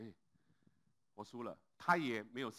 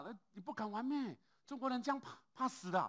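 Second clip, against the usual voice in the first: mocking taunt; 11 semitones higher than usual. Lawyer taunting Lao Lin.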